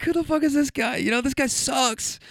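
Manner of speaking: high, mock-indignant grumble; imitated disgusted rivals. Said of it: "they're like".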